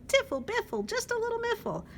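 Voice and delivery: high, loud singsong nonsense; bouncy high chirpy rhyme; silly bird song. Acted. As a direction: chirp it out gleefully.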